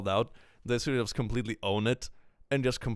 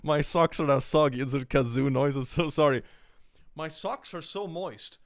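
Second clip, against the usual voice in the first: mock tearful apology; 5 semitones higher, 3 dB louder than usual. Creator fakes sorrow over soggy socks, lightly voiced. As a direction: fake a sorry whimper.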